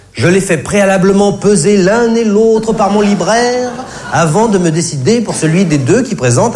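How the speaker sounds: ordinary talk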